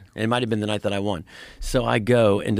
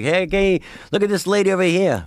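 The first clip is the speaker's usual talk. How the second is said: lightly voiced rabbit comic, raised in pitch; a higher mock heckle for the rabbit; rabbit puppeteer's voice; 7 semitones higher, 4 dB louder than usual. Hidden handler voicing a rabbit comic heckling a lady, lightly put-on voice. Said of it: "[name] is down there doing the voice".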